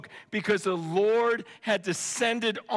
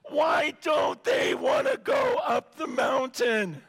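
pleading cry; 7 semitones higher, slower than usual. Retold or imitated. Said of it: "And he says".

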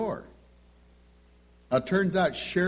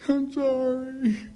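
put-on much higher, slow sulky child voice; little girl's apology; 11 semitones higher, much slower than usual. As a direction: drag out a reluctant little-girl apology. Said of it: "Karen's like this".

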